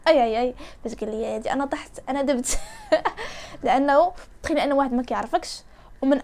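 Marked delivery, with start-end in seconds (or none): 2.6-3.6 short high-pitched laugh. Real, not acted. Girl recalling how she fell for him, laughing. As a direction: giggle at your own embarrassment. Laughs at her own story.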